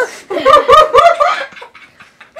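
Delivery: high, loud burst of laughter. Real, not acted. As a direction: laugh out with delight. Laughing during the game.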